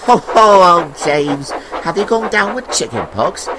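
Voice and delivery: high, loud-starting mock concern; teasing engine. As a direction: coo it high at the spotty engine. Engine mock-asks about James's spots.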